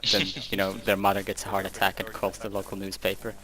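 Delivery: usual speaking voice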